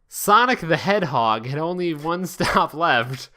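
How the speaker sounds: ordinary delivery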